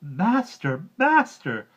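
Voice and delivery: high, loud alarmed cry; shrill alarm call; harp crying out. A narrator's reading. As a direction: call for help in a panic. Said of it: "cried out loudly".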